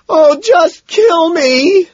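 high, loud, drawn-out tearful wail. A narrator's reading. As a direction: wail it out in despair. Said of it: "he wails".

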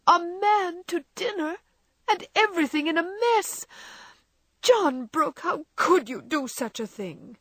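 high, tearful, reproachful outcry; indignant dismay. A narrator's reading. Wife crying out at her husband for bringing a guest, indignant and tearful. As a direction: wail your reproach at him in dismay. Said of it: "indignation, reproach, and dismay".

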